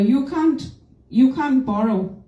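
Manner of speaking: ordinary delivery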